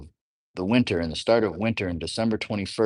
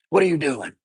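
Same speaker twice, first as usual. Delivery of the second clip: a louder, higher demanding what are you doing; sharp scolding question; 7 semitones higher, 4 dB louder than usual. Shown as a demonstration. Parent to child, a louder sharp demand. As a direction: snap it out like catching a kid at mischief. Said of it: "there's a certain way I say it".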